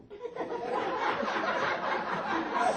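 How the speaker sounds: a burst of high, airy laughter; giggling fit, high and breathless; high breathy laugh. Real, not acted. Laughs at the pager message.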